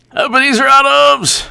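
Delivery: very high-pitched cry; mock exclamation. Commentator suddenly exclaiming a name on air, high mock cry.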